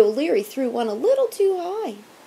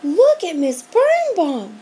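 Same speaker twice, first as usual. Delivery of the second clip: loud, high stammering alarm; too scared to find the word; girl shouting at the monster teacher; 8 semitones higher, 6 dB louder than usual. A narrator's reading. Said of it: "shouted Sherry Shackmeyer".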